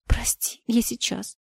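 whispered through tears, barely voiced; tearful whisper. A narrator's reading. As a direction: breathe it out softly, holding back tears. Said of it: "и слёзы покатились по щекам". (сквозь слёзы шёпотом)

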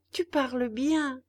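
a hungry puppet complaining to his father; puppet boy voice. Acted. Puppet answers back.

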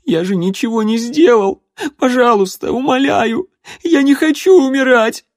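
crying high and loud; sobbing plea. A narrator's reading. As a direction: beg for life through tears. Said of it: "заплакал".

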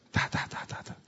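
soft unvoiced ta ta ta; counting under the breath. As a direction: tick off each fry in a breathy whisper. Counting the fries one by one. (contando las papas en un susurro)

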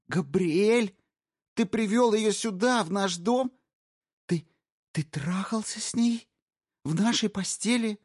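high, loud, shocked fury; outraged disbelief. Acted. Wife confronting her cheating husband, high outraged disbelief. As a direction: throw each question at him.

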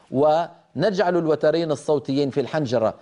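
normal talking voice

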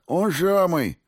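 high, lisped confirmation; lisping injured thug. A narrator's reading. Beaten thug confirms the name to interrogator, lisping. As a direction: lisp it through a hurt mouth.

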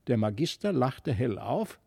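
usual speaking voice

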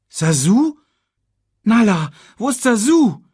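high, loud anxious question; worried calling. A narrator's reading. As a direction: call out for a missing friend. Cub notices his friend is missing.

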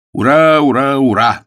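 loud, high cheering; jubilant shout. A narrator's reading. McMurdo learns Agnes will marry him, shouting hurrah. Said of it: "закричал Макмердо".

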